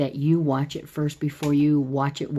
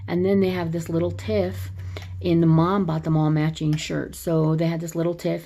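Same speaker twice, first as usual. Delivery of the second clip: usual speaking voice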